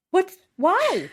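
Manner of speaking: high incredulous why; mock disbelief; baffled. Viewer baffled by the noise, high incredulous.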